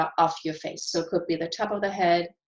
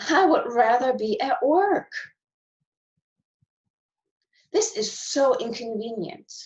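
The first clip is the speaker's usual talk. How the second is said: frustrated venting; 2 semitones higher than usual. Shown as a demonstration. Coach venting lockdown frustration while tapping, raised voice. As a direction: vent the complaints like you mean them. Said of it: "I'm gonna start with some frustration".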